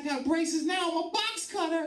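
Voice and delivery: put-on high whiny kid voice, a touch upset; mock upset teen niece. Comic telling uncle about braces, whiny high girl voice. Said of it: "She said".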